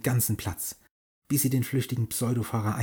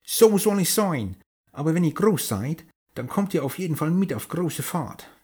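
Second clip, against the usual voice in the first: put-on old sailor; 7 semitones higher, 4 dB louder than usual. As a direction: boom it out, laughing and jolly. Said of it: "lachte der Captain und rief lauthals".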